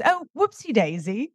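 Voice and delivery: lightly imitating Hugh Grant's British accent; a hint of a posh Englishman's whoopsie daisy, rising; mock English gent stumbling over a fence; British quote. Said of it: "and he goes".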